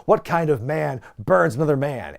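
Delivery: lightly voiced mock-indignant wrestler; a hint of Jericho's offended outrage; Jericho quote. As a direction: play the offended heel, lightly.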